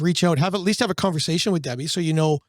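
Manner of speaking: ordinary talk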